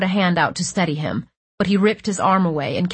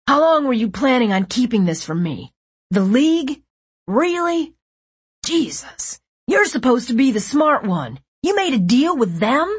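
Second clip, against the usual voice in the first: higher, louder betrayed shouting; angry outburst; 6 semitones higher, 4 dB louder than usual. A narrator's reading. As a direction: blast him with betrayal.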